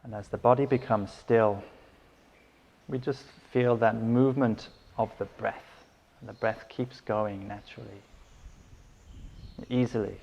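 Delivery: unhurried meditation guidance with long pauses; guided meditation. Acted. Teacher settling listeners into breath and body, calm guided meditation. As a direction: guide them gently into stillness, leaving space between phrases.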